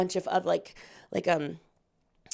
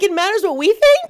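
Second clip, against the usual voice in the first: amazed disbelief; 12 semitones higher, 9 dB louder than usual. Retold or imitated. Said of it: "we were all like".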